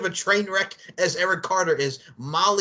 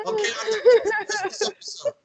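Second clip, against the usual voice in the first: high, cracking voice through laughter; words squeezed out while laughing. Real, not acted. Host describing the video to cohost, laughing through words.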